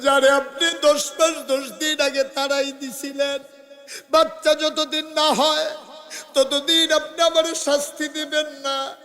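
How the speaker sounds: high, tearful preacher's chant; tearful pleading. Acted. Returning woman reminding the Prophet of his promise, tearful chant.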